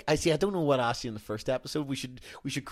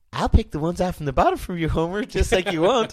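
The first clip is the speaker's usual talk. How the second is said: higher, quick woman character voice; raised-pitch impression, quick; Maud Flanders; 5 semitones higher, 4 dB louder, faster than usual. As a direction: lift the pitch for a cartoon housewife. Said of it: "none other than Maud Flanders".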